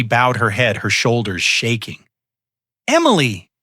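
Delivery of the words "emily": high shocked gasp of a name; mother crying out her daughter's name in shock. A narrator's reading. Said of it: "Beatrice gasped".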